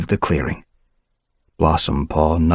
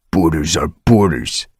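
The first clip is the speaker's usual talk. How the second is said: growl; 3 dB louder than usual. Acted. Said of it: "he growled".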